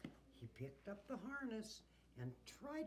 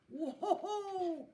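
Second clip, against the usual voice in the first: high, loud "whoa"; long yell to halt runaway reindeer; alarmed, drawn-out holler; cried out; 12 semitones higher, 12 dB louder than usual. A narrator's reading. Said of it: "Santa cried".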